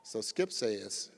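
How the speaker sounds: ordinary talk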